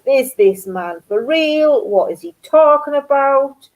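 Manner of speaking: baffled retold question, stretched and rising; incredulous. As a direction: ask it in mock exasperated disbelief. Retelling her baffled reaction.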